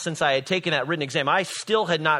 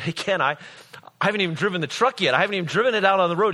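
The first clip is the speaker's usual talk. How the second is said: panicked protest; 5 semitones higher, much faster than usual. Retold or imitated. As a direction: blurt it out fast and high like a scared young driver. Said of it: "I was terrified".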